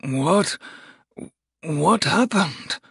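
dazed, quavering question; slow, wavering bewilderment. Acted. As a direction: stammer it out, stunned and unsteady. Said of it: "A quavering voice spoke behind him".

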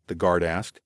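ordinary delivery

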